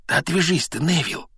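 hushed but high-pitched brush-off; irritated. A narrator's reading. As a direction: shoo him off in annoyance without raising volume. Harry shakes off Neville's tugging. (раздражённо, высоко, но негромко)

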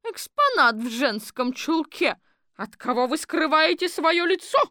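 mock the strange exhibit; sporty citizen woman. Четверухина допрашивает. (насмешливо, высоким громким голосом)

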